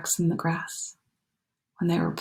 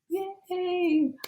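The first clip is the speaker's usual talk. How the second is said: soft cheer; 12 semitones higher, 6 dB quieter than usual. Real, not acted. Cheering at happy end.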